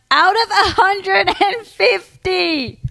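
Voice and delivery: loud, high, drawn-out incredulity; incredulous read-out. Acted. Podcast host reading out a bride's entitled post, loudly incredulous. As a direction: stretch out the figure in loud disbelief.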